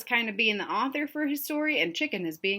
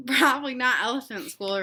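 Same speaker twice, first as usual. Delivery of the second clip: ordinary delivery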